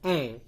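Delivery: "E" as an open è; "E" as in keset; open E sound. Shown as a demonstration. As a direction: say "E" like "eh" in "bed". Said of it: "kadang dibaca E".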